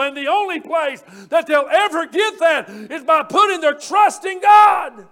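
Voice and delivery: real hollering preacher building to a shout. Real, not acted. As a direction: lift it into a full shout at the end. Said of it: "Sorry, I'm hollering on a Wednesday night".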